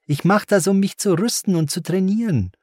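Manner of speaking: put-on high-pitched child protesting; high, insistent boy's voice; defiant boy. A narrator's reading. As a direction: argue back like an indignant kid. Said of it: "wehrte er sich".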